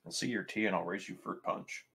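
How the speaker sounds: ordinary delivery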